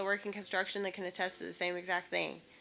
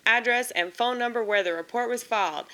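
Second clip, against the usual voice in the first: louder, brisk bureaucratic read of form questions; form read aloud; 2 semitones higher, 7 dB louder than usual. Acted. Vlogger reading each insurance form question aloud, exasperated formal read. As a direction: rattle off the questions with growing exasperation.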